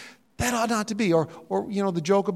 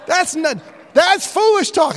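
high, shouted objection in mock alarm; loud protest; 11 semitones higher, 9 dB louder, slower than usual. Preacher retelling his protest at a mistimed amen, shouted. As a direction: cut in loudly to undo the blunder.

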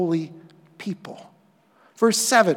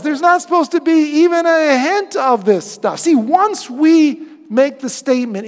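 ordinary talk, nothing marked

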